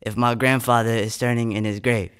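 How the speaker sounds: intense, rising performance poetry delivery; spoken word poem. Acted. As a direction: perform the poem with mounting urgency.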